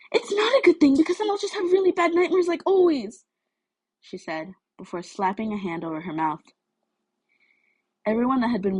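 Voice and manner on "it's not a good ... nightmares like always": upset blurt. Acted. Mina blurts out her fear.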